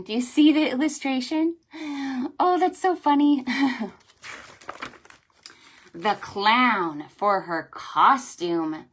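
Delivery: normal talking voice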